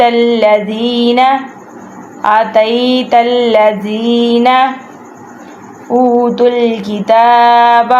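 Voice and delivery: slow, measured tajweed recitation with repeats; Quran recitation. Shown as a demonstration. Teacher recites verse for students to follow, measured chant. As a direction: chant each phrase, then repeat it.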